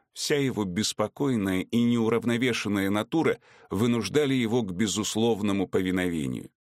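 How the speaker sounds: ordinary delivery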